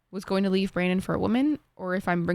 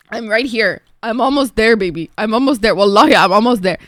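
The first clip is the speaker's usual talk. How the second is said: loud, insistent hype; playful, emphatic excitement; excited; 9 dB louder than usual. Acted. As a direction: build up loudly, almost at the goal. Excitedly tracking place on wait list.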